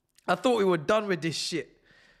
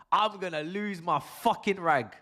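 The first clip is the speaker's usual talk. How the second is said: ordinary talk, nothing marked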